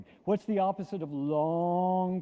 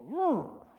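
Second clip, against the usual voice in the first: mock roar; 9 semitones higher than usual. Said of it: "cause us to go".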